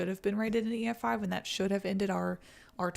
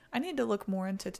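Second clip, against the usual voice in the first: ordinary talk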